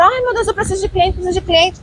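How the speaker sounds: high, fast fretting plea; hurried high-pitched whine of worry; mock desperation. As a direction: imitate someone panicking, rattled off quickly. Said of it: "naquela energia de desespero".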